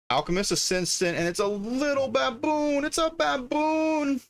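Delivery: squeaky, held-out delight; delighted squeal. Real, not acted. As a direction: gush over something adorable, way up high. Delighted by cute baboon card.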